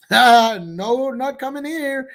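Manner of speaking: high, hammed-up French accent mocking a politician. Mocking Macron's refusal in a silly French accent. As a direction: put on a jokey French accent, way up in pitch. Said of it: "that was an, uh, an attempt on a French accent".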